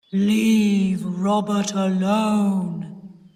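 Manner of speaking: slow, loud, drawn-out deep voice; deep ghostly voice. A narrator's reading. Sis speaking into the rainpipe to her aunt, deep voice. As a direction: stretch each word out like a ghost. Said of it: "she said in a deep voice".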